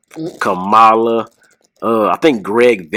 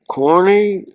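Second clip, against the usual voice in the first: high, softer drawn-out voice; Terry Funk; 8 semitones higher than usual. Retold or imitated. Wrestler answering in Jim Cornette's accent, imitated softer voice. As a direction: imitate a soft, high-pitched questioning voice. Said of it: "kind of a softer voice".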